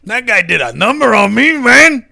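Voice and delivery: sing-song Chicano stoner complaint; caricature Chicano accent. Acted. Comic as botched-surgery Olmos complaining, caricature Chicano accent. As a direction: grumble in an exaggerated Chicano accent, vowels stretched. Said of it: "this worst caricature stereotype".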